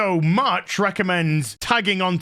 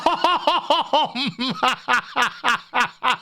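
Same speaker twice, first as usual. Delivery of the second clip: high staccato laugh, running out; long helpless laugh; 5 semitones higher than usual. Real, not acted. Reviewer floored by the bomb story, long helpless laughter. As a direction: laugh until the breath is gone.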